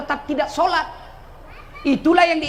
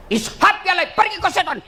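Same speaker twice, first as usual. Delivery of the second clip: Ibrahim chasing off Satan; 7 semitones higher than usual. Acted. Ibrahim drives Satan away.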